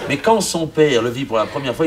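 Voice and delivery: usual speaking voice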